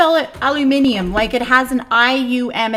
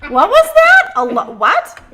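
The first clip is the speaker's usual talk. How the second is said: baffled surprise; 11 semitones higher, 6 dB louder than usual. Real, not acted. Baffled by his imitation.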